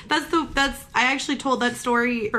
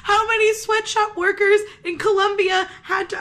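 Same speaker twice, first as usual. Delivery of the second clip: laughing so hard the drink sprays out; spit take; 8 semitones higher than usual. Real, not acted. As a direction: burst out laughing mid-sip, spraying. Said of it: "I just spit all over your nice ikea table".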